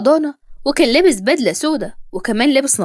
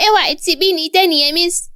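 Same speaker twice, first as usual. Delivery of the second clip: put-on high, crying little boy's plea; tearful child; 5 semitones higher than usual. A narrator's reading. Boy begging his teacher to stay, tearful. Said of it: "وقال بعياط".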